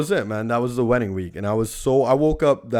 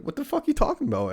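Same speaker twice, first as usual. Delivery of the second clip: high, fast disbelieving retort; incredulous outburst; 14 semitones higher, 4 dB quieter, faster than usual. Retold or imitated. Retelling his reply to the DJ, fast and high in disbelief. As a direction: blurt it out like you can't believe it. DJ says guests wilding out.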